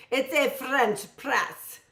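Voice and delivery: "French press" said slowly in a mock French accent. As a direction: drag out "French press" like a Parisian. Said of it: "So that was supposed to be my French accent".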